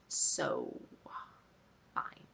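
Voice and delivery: barely voiced, giving up; whispered resignation. Real, not acted. To herself after the nurse refuses help, whispered resignation. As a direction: let the words drop to a whisper.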